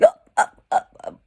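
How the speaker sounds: loud hiccup gasps "¡ah, ah, ah, ah!". Shown as a demonstration. Teacher showing the students a hiccup, loud. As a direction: hiccup in sharp bursts. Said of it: "Es un hipo".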